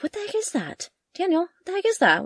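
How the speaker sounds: high-pitched puzzled what-is-that; replaying her own baffled question to Daniel; retold surprise. Retelling spotting something far off.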